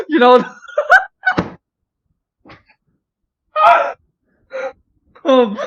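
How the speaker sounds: high squealing laughter; can't stop giggling; giggling fit. Real, not acted. Friend cracking up about his own face, high giggles.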